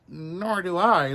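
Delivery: lying. Acted. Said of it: "lied Pinocchio".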